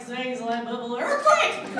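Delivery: slow, loud, hammed-up earthquake yell; mock booth panic. Imitating a colleague caught in an earthquake, loud and slow. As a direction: ham up the panicked actor's yell. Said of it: "he's like".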